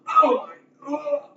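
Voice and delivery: high, slow astonished cry; shocked gasp. Acted. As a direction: gasp in disbelief at the feat. GM voices NPC reacting to lift.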